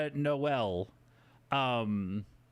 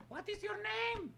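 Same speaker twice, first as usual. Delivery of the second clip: high puppet voice; 5 dB quieter than usual. Acted.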